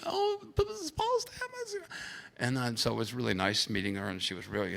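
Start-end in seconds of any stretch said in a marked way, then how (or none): 0.0-1.8 put-on very high, softer child's announcement; little girl voice. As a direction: pipe it up like an excited little girl. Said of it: "ran back to her parents saying".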